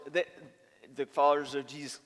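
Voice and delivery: usual speaking voice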